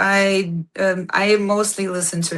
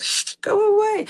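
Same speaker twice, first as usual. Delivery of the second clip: high, dismissive put-on preacher voice; hammed-up preacher brushing someone off; mock preacher shooing. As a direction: shoo the tattooed outcast away in a high mock hush. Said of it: "it's like".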